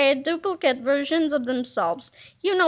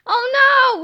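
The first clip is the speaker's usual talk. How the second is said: high, loud oh no; alarmed kids shouting; panicked cry; 8 semitones higher, 6 dB louder than usual. Acted. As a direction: yelp in cartoon panic.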